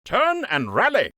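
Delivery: high, ringing drill command; called command. A narrator's reading. Lieutenant calling drill to soldiers, ringing command. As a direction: call it across the whole line. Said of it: "called out".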